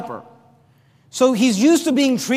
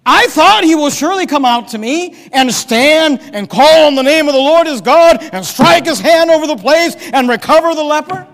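louder and higher, full of wounded pride; indignant Naaman; 4 semitones higher, 6 dB louder than usual. Acted. Preacher voicing Naaman's indignation at Elisha, louder and higher. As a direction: pour out Naaman's offended demand.

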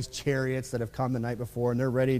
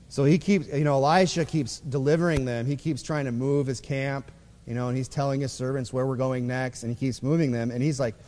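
ordinary delivery

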